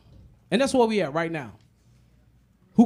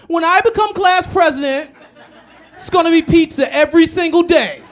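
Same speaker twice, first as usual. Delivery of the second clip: squeaky-high child candidate, hammed up; kid candidate voice; 10 semitones higher, 10 dB louder than usual. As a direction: play a kid promising pizza at an assembly. Mimics kid running for president.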